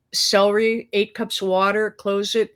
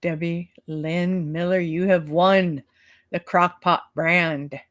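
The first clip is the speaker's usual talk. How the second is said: slow, drawn-out game-show prize announcement; prize announcer; slower than usual. Pretend. Co-host telling the giveaway winner of the prize, announcer style. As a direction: spell out the win with ceremonial weight.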